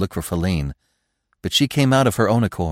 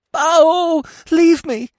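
very high, loud deer bleat; loud, piercingly high animal cries; hysterical bleating; 8 dB louder, much slower than usual. A narrator's reading. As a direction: wail shrilly like a panicked fawn. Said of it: "She bleated again".

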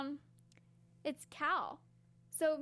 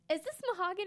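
Shining quote; 5 semitones higher, faster than usual. Retold or imitated. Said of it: "the Shining reference".

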